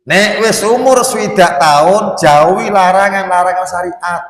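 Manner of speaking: loud, high preacher's call; preaching at full force. Acted. Preacher warning the elderly congregation, loud and high. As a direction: lift the warning to the whole hall.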